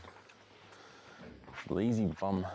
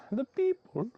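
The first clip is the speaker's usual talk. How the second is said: high, louder squeak; high pet voice; 4 dB louder, slower than usual. Real, not acted. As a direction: squeak it playfully. Baby talk.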